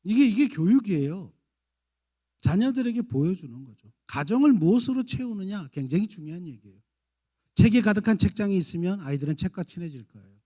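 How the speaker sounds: loud, emphatic sermon; forceful preaching. Acted. Preacher urging parents about the home, loud and emphatic. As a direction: drive the lesson home hard.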